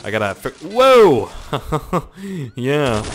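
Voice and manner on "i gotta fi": normal talking voice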